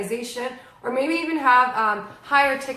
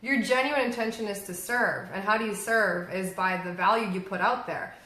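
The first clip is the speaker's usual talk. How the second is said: ordinary delivery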